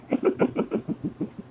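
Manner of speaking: soft breathy run of chuckles; quiet chuckle. Real, not acted. Teacher amused that viewers' fun is over, quiet chuckle. Teacher laughs at own joke.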